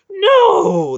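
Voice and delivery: high, loud anguished wail. Acted. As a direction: wail it out in despair. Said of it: "the bald hierarch wailed".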